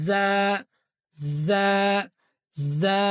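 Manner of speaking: জা with a buzzing z; zay sound. Shown as a demonstration. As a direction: recite জা as a z. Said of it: "এরকম একটা sound হবে".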